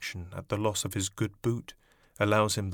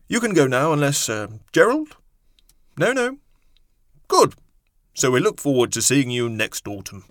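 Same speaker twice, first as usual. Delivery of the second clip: loud, high, flustered professor; flustered don; 3 semitones higher, 6 dB louder than usual. A narrator's reading. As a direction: bluster through the names. Flustered interviewer voiced.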